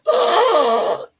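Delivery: high, loud shocked "Oh!"; an imitated horrified gasp. Mimicking therapists reacting to a harsh comment, shocked gasp. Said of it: "Every mental health professional out there just went".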